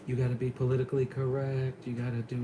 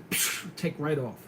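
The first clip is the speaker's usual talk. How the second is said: ordinary talk, nothing marked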